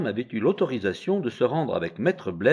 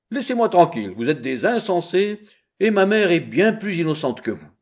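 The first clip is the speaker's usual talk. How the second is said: put-on annoyed child; 8 semitones higher, 5 dB louder than usual. Child rebukes the women.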